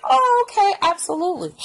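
high-pitched over-polite reply; put-on high agreeable voice; mock agreement. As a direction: pitch it way up, falling at the end. Acted reply to unhelpful stranger.

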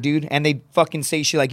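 ordinary talk, nothing marked

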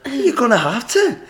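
high-pitched Scottish customer voice; mock an insistent Scot, pitched high; Scottish accent. Scottish customer demanding a new drink, high Scottish accent. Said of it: "these Scottish fellas came up".

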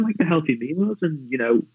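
normal talking voice

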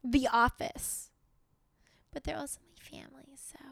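ordinary delivery

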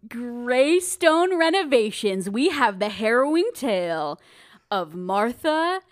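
slow, dramatic show promo voice; booming trailer narrator; trailer announcer. Acted.

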